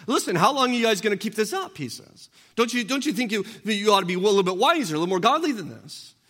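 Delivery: a preacher voicing David's high, insistent appeal; pleading David. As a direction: beg them to stop it.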